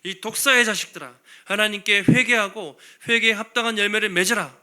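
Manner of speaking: loud, blunt prophet's denunciation; John the Baptist thundering at the crowd; blunt rebuke. Acted. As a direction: hurl it at them like a fastball. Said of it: "엄청나게 막 직설적이죠".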